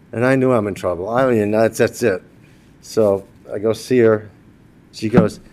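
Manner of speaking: ordinary delivery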